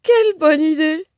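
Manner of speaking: high, loud swooning cry; moved lovesick moan. A narrator's reading. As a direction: gush like a smitten fool. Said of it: "Gémit Honoré d'une voix émue".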